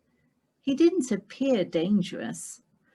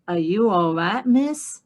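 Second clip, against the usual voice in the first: lightly voiced American cowboy drawl; easy American cowboy, unhurried; American cowboy accent; 6 dB louder than usual. A narrator's reading. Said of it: "suspiciously American accent, deep and slow".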